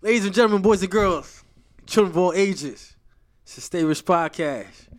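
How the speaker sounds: loud, high showman announcing the act; ringmaster. Pretend. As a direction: boom it out like a circus ringmaster. Podcast guest announces show grandly.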